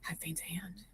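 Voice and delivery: hushed conspiratorial whisper. Acted. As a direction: whisper it like a secret. Whispered in-character plotting.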